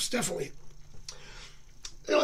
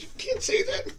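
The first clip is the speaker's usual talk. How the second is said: much higher, shocked scolding; hushed horror of an embarrassed mother; mother hushing her son in front of her friends; scolding mom; 13 semitones higher than usual. Acted. Mom scolds boy in joke.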